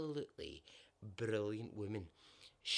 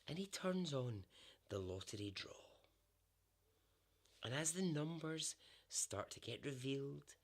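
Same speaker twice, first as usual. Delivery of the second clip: story narration; 3 semitones lower, 6 dB quieter than usual. Acted. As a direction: draw the listener into Bud's big moment.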